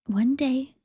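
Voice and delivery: ordinary delivery, nothing marked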